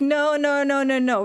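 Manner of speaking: imitated hasty backpedalling; retold urgent correction. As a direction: rush to clear up a misunderstanding. Retells hurried reassurance of misunderstanding owner.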